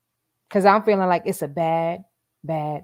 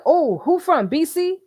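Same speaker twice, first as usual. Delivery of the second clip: mock-excited, much higher and louder; imitating excited Nesto; 10 semitones higher, 4 dB louder than usual. Streamer imitating Nesto hoping the caller was his lawyer, mock-excited and high. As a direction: jump up eagerly like hoping it's the lawyer. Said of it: "He's all excited".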